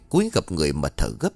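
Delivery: ordinary delivery, nothing marked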